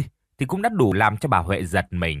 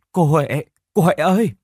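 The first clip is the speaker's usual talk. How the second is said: calling out, louder and higher; desperate call; 6 semitones higher, 4 dB louder than usual. A narrator's reading. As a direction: cry out for her aunt in distress. Crying niece runs in calling.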